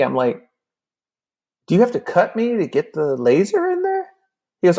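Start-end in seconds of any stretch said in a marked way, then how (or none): 1.7-4.1 high, anxious question; hammed-up nervous squeak; dawning worry. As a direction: ask it pitched way up, dreading the answer. Worried question as it dawns.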